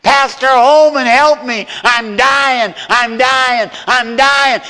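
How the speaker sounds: hammed-up high, loud cry of terror; frantic mock scream. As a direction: yell like a grown man terrified of a dog. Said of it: "And he was screaming".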